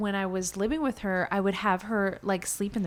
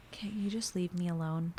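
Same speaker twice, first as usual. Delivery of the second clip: whispered, tired plea; pleading softly; 3 semitones lower, 8 dB quieter than usual. Retold or imitated. Asking men following her to stop, whispered plea. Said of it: "I'm like".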